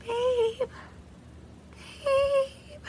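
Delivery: high, pleading babe voice, a touch of crying; hammed-up husband begging his wife; mock whiny husband. Husband coaxing his grieving wife, mock whiny pleading.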